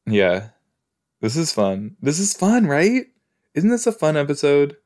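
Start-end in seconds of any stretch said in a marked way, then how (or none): 2.0-4.8 high, rising "fun, right?"; high-pitched, insistent upswing; pressing for agreement. Real, not acted. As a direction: lift it high as a hopeful question. Repeats it, seeking agreement.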